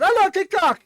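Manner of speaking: high, loud, sarcastic cheer; mock cheerful. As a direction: sell it like a fake happy slogan. Reading a forced screen slogan.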